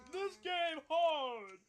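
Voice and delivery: very slow, loud, high caveman shout; caveman talk. Pretend. Gamer moaning about the game as a caveman. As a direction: drag it out like a dim caveman. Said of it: "my speech pattern went from educated person to fucking caveman".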